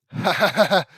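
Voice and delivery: loud, high cackling laugh; performed burst of laughter; acted laugh. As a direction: belt out a put-on "ha ha ha". Voices a character's laugh.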